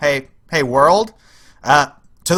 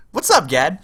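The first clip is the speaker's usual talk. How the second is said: ordinary talk, nothing marked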